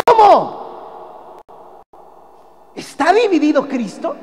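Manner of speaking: high, loud astonished ¿Cómo? rising on Cristo; the right way to read the verse, full of admiration; amazed exclamation. Shown as a demonstration. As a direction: ask it in stunned disbelief. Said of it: "pues ya no entendió, porque así no es".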